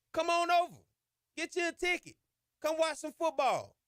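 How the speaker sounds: calling out. Real, not acted. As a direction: shout the invite across town to rival fans. Calling out to LSU fans.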